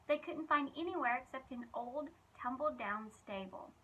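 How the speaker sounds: usual speaking voice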